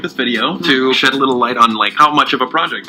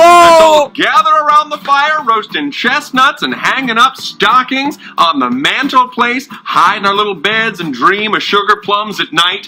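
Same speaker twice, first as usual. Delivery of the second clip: put-on country accent; 9 semitones higher, slower than usual. Roman puppet mocking Christmas in a country accent. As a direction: lay on a country accent, Christmas-card cheer. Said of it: "speaking in sort of a country accent".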